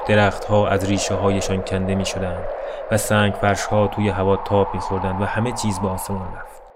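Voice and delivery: usual speaking voice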